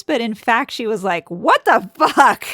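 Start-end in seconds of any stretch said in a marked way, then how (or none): 1.3-2.5 louder, higher, drawn-out mock outrage; retold indignant exclamation, slow and raised; indignant outburst. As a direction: stretch it out in acted disbelief. Retold outraged inner reaction.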